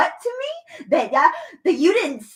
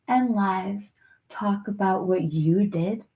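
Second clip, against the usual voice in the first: ordinary talk, nothing marked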